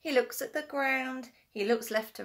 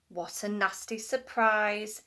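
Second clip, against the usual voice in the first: ordinary delivery, nothing marked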